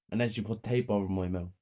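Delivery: ordinary delivery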